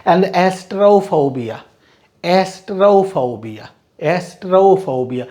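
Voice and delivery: एस्ट्रोफोबिया drawn out slowly, es-tro-pho-bia; model pronunciation. Shown as a demonstration. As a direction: repeat एस्ट्रोफोबिया slowly like a teacher. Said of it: "तो एस्ट्रोफोबिया इनमें से कौन सा शब्द है".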